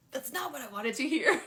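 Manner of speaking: loud, high grumble breaking into laughter; laughing mock complaint. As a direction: complain playfully through a laugh. Retold complaint to Gaia, laughing.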